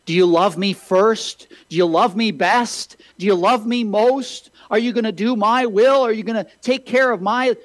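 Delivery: rising preacher's plea in God's voice; building appeal. Retold or imitated. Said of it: "God gives us the same question".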